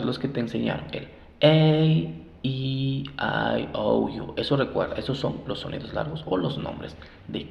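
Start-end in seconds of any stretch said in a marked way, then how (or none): 0.9-4.3 "A, E, I, O, U" as English names ey, ee, ai, ou, yu; long vowel sounds. Shown as a demonstration. Teacher reviewing with learners, long English vowel names. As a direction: recite the vowels long, stretching "A" and "E". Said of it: "se deben decir sus sonidos largos".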